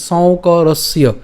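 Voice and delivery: সৌকরস্য said slowly as one word, shaukorossyo; slow joined word. Shown as a demonstration. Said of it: "একসাথে করবো". (ধীরে একসাথে সৌকরস্য)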